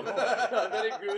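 loud, slow hammed-up Scottish accent with a laugh; mock Scottish accent. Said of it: "How about Scottish?".

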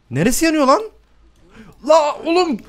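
high, alarmed yelp; panicking at the fire. Real, not acted. Gamer sees his house burning, alarmed cry.

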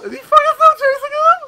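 shrieking at the screen in disbelief; incredulous shout. Real, not acted. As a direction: yell it in disbelief, cracking up. His target is still fleeing in-game.

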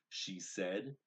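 ordinary delivery

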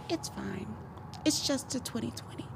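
lightly voiced laid-back driver shrugging it off; chill driver. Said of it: "was just chill".

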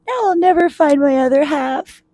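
high-pitched mock crying voice; acted storybook sobby lament; tearful wail. A narrator's reading. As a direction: wail it like a lost little mitten. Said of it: "Mitten started to cry".

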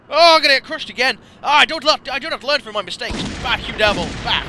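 loud, high panicked protest; frantic gamer outburst. Real, not acted. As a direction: yell in alarm as you get attacked. Panicking as monsters attack him.

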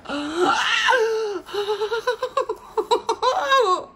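sharp, high gasp of delight; real gasp that turns into a laugh. Real, not acted. Sees the Wonka painting.

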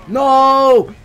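high loud anguished yell; loud shout. Real, not acted. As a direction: scream it in dismay. Teammate dies, cries out.

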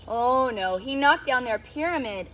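ordinary delivery, nothing marked

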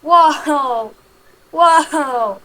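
głośny okrzyk zdumienia; amazed shout. Real, not acted. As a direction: wykrzycz z zachwytem. Loud amazed reaction in game. (głośne, zdumione „wow”)

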